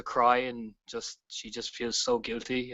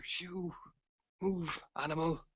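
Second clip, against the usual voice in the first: hushed, higher-pitched shoo; whispered shooing; 5 semitones higher, 8 dB quieter, slower than usual. Acted. Player shooing the wolf off the stairs, whispered. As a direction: whisper it softly to wave an animal off. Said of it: "I kind of whisper".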